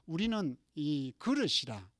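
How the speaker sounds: ordinary delivery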